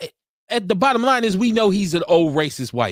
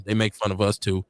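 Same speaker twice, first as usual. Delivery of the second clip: normal talking voice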